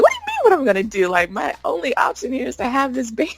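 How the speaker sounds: high, fast disbelieving comeback; mock-indignant what-do-you-mean; incredulous retort. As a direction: fire it back in disbelief, pitch way up. Retold indignant reply to friend.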